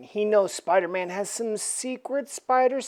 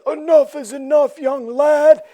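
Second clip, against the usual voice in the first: stern leader; 6 semitones higher, 7 dB louder than usual. Acted. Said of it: "He says".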